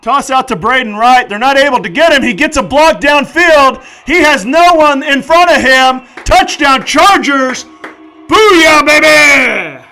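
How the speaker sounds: loud, high touchdown call; excited touchdown call. Real, not acted. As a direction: call the score with full hype. Touchdown call on live play-by-play.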